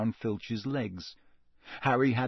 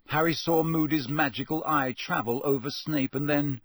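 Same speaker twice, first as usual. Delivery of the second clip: ordinary delivery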